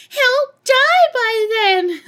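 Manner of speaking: high, loud, drawn-out mock wail; over-the-top shrieking phone panic; hysterical wail. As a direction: wail it slowly in mock despair. Acted hysterical wife on the phone.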